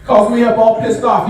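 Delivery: ordinary talk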